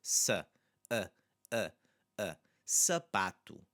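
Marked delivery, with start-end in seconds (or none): 0.0-2.3 sa, then short separate "uh" sounds; repeated short "uh" for the first A; unstressed A. Shown as a demonstration. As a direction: isolate the reduced "uh" from sa. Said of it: "first A, though, here is the one".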